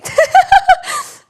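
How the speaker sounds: very loud, high burst of laughter; high cackling laugh. Real, not acted. Streamer laughing at own tears, loud high cackle.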